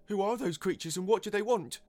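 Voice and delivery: high, loud alarmed question; crying out. A narrator's reading. Second onlooker asking about the creatures, crying out. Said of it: "cried another".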